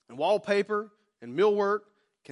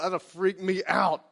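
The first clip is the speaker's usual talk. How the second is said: ordinary talk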